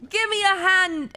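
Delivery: high, hammed-up Australian call; attempted Australian accent. Streamer trying an Australian for fun, hammed-up Aussie accent. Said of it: "I can't do an Australian".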